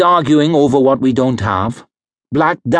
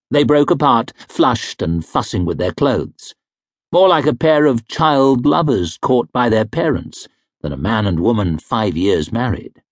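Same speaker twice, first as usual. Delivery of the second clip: usual speaking voice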